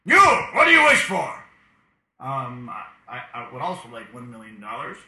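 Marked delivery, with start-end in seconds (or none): 0.0-1.3 booming genie voice. Acted. Genie asks wish.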